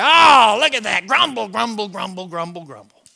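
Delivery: loud mock groan dying into grumbles; hammed-up mirror dismay; dismayed grumbling. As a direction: grouse at your own reflection, fading out.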